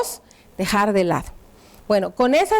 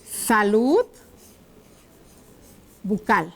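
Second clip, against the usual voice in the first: dictating while writing; 4 semitones lower, slower than usual. Shown as a demonstration. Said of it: "es lo que voy a escribir acá".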